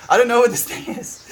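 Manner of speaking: giggling bewilderment; laughing through it. Retold or imitated. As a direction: laugh at your own cluelessness. Retelling her baking confusion.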